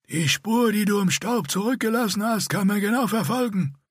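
rasping scarred warrior. A narrator's reading. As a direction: scrape each word out like a rasp. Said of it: "Die Stimme des Kavallaren machte jedes Wort zur schmerzhaften Anstrengung".

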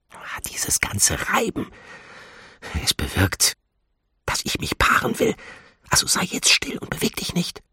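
hushed, voiceless admonition; secretive breath-only whisper. A narrator's reading. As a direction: whisper it close to the listener's ear. She whispers while hiding in trunk.

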